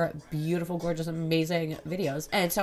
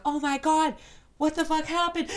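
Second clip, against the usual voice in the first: much higher alarmed quoting of commenters; mock shocked fans; 10 semitones higher, 4 dB louder than usual. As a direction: play the panicked fans. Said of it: "being like".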